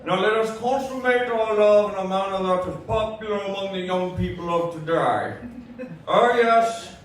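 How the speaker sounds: lightly voiced stiff formal gentleman, laughing through it; prim, proper commentary on a sex scene; mock Hitchcock. Comic narrating a seduction in prim Hitchcock voice. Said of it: "thin Alfred Hitchcock".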